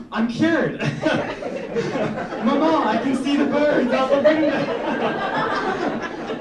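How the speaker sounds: high, loud, awestruck cry of joy; ecstatic miracle cure. Pretend. Waking up with clear eyes, crying out to his mama in ecstasy. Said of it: "I'll wake up and be like".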